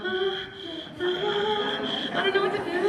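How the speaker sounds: high, fretful voice of a panicked dog; flustered dog voice. Retold or imitated. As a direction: whine it like a dog that can't decide. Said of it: "walk around and go".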